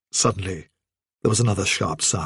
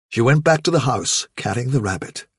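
ordinary delivery

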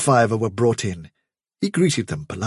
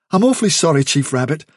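higher, louder deferential voice; apologetic; 6 semitones higher, 4 dB louder, faster than usual. A narrator's reading. Hazel excusing his brother to the chief, apologetic. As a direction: apologise hastily to a superior.

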